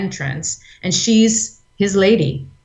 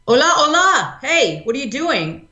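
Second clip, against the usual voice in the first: high, bright-edged call to stop the fight; Carmen cutting in; 9 semitones higher than usual. Retold or imitated. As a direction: burst in high to break it up.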